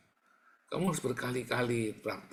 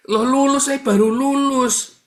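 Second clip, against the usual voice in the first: much higher and louder, stretched out; loud self-mocking protest; 14 dB louder than usual. Real, not acted. Preacher mocking his younger self, high loud protest. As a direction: cry out the excuse in mock indignation.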